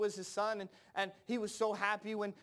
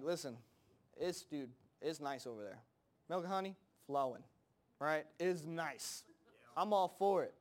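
low, soft, casual Caleb voice; understated, easygoing approval; laid-back report; 6 semitones lower, 3 dB quieter than usual. Retold or imitated. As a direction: play it cool, like telling a buddy.

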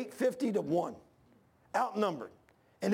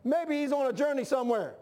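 mocking taunt; 5 semitones higher than usual. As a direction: needle them like Elijah mocking Baal.

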